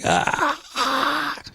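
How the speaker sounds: high mock wail through a megaphone; hammed-up high wail; mock screaming woman. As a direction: imitate a protester shrieking into a megaphone. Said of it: "a woman who has a megaphone and like".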